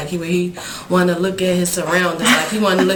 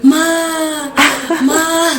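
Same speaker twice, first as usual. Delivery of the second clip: put-on higher, louder crying baby calling Ma; imitating her baby son; 9 semitones higher, 8 dB louder than usual. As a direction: call out like a toddler crying for mom. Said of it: "it sounded like he was like".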